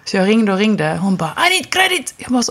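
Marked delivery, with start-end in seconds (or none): 1.5-2.1 much higher, mock Chinese-accented English; imitating a Chinese accent. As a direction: impersonate the Chinese landlady's accent, pitched way up. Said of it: "en kinesisk tjej som svarade med en brytning".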